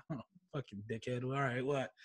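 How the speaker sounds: low, quiet muttering; grumbled aside. Retold or imitated. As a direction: grumble resentfully under your breath. Grumbling reaction to the principal's summons.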